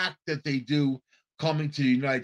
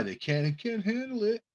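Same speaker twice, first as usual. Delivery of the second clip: softer, mock-tearful whimper; mock crying; 5 semitones higher, 6 dB quieter than usual. Mocking officials crying they can't cope, softly whimpering. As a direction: whimper it like a crybaby. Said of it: "listen to how they cry".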